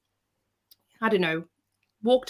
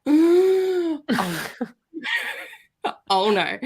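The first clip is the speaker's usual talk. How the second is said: nervous laugh; 10 semitones higher, 3 dB louder, much slower than usual. Retold or imitated. Palm being read.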